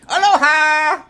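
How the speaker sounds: high, loud, drawn-out hail; calling out a greeting. Real, not acted. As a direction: shout it joyfully to a friend. Calls a greeting to named viewer.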